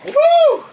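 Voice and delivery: high, loud whooping cheer; hype whoop. Real, not acted. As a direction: let out a big whoop. Celebrating the tornado trick.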